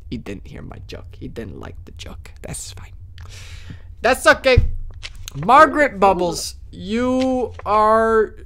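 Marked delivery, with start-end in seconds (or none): 0.1-2.9 soft, unvoiced aside; whisper. Real, not acted. Streamer consoles himself that Dennis missed the joke, whispering. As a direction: whisper it to yourself.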